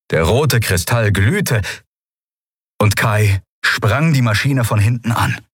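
ordinary delivery, nothing marked

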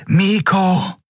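hoher verzweifelter Schrei. Acted. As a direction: schrei den Namen hoch heraus. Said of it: "Kapitän Naguns Schrei". (hoher verzweifelter Aufschrei)

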